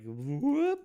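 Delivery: high quick whoop; whoop sound effect. Retold or imitated. Describing the legs coming first, high whoop. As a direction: pop it up like something springing. Imitating sudden motion.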